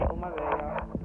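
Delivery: ordinary talk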